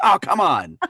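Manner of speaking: loud, high protest; exasperated outburst, played for laughs; mock exasperation. Acting out exasperation at casting.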